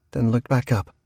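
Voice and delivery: usual speaking voice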